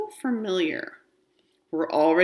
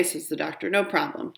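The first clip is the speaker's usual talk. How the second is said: usual speaking voice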